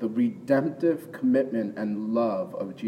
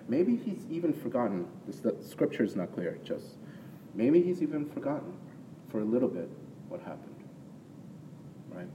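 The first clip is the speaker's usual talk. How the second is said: ordinary talk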